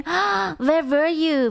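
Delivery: gasp, then high, slow reproach; mock shock. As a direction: gasp and draw out the question accusingly. Feigned shock at missed debut.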